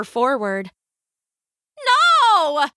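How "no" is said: high, loud cry of dismay. A narrator's reading. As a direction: cry out "no" in alarm, pitched way up. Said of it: "cried a woman standing by".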